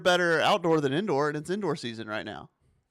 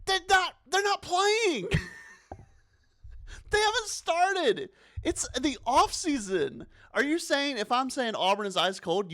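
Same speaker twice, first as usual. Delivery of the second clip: much higher, worked-up defensive rant; heated rant; 10 semitones higher than usual. Real, not acted. Host answering fans who defend baseball, heated high rant. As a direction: argue back, getting wound up.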